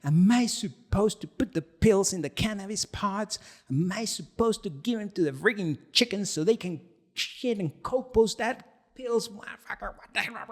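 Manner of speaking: high, hammed-up angry tirade; imitating a raging buddy on the phone; mock fuming rant. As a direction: rant like a furious friend who got the wrong thing. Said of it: "He was fuming".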